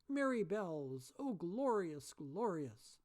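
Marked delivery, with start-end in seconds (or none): none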